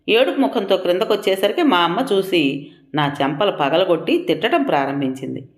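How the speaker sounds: ordinary delivery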